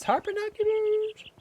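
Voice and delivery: very high, slow put-on voice; silly squeak. Streamer after thanking viewer for bits, silly high squeal. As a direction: stretch it out in a squeaky falsetto.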